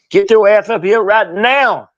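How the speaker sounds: high, loud barked order; angry boss hollering down the phone; Dusty impression. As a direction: order him in with a long rising-falling yell. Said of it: "impersonations of guys besides Dusty".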